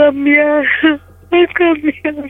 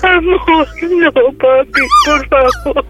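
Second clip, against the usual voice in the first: desperate pleading; 3 semitones higher than usual. Real, not acted. Woman begs her partner not to hang up, desperate pleading. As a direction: beg them to stay on the line.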